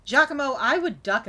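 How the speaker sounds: loud warning call. Real, not acted. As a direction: shout the name across the room. Calling a warning to his companion.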